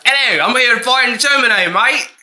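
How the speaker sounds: high, broad mock Australian accent; botched British, sounding Australian. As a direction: aim for British and land in Australian. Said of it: "why does that sound so Australian?".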